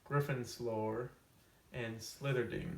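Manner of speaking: ordinary talk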